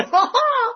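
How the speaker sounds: high, loud gloating ha ha; mock a man who thinks he's won; triumphant laugh. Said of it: "he thought he struck gold here".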